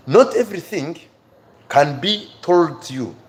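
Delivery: ordinary talk, nothing marked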